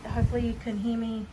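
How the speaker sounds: muffled through a dust mask. Real, not acted. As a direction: speak from behind a face mask. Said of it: "I've got a mask on".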